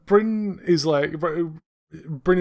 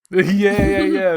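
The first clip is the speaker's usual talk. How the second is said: normal talking voice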